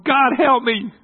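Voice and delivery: high, very loud desperate cry; inner cry for help. Retold or imitated. Son outwardly calm, inside crying out to God, desperate shout. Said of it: "inside I'm going".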